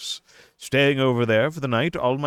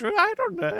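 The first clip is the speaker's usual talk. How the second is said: squeaky denial. Acted. Playing coy about the mustache.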